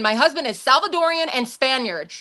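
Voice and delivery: ordinary talk, nothing marked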